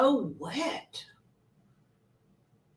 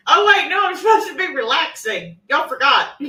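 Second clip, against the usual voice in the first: laughing; 3 semitones higher, 8 dB louder than usual. Real, not acted. Laughing at own mask.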